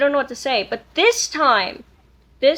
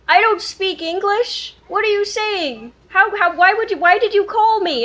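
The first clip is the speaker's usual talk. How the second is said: imitating a puzzled stranger on the phone; confused caller; 7 semitones higher, 4 dB louder than usual. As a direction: play the baffled caller asking why you phoned. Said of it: "And he was like".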